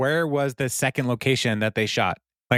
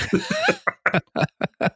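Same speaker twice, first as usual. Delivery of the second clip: high squealing laugh. Real, not acted. Big laugh.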